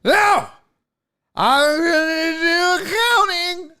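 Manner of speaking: high, slow, slurred insistence; imagined drunk heckler. Pretend. Drunk stranger won't leave the accountant alone, slurred high insistence. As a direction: whine it out stubbornly, drunk and garbled. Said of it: "First of all, you're drunk".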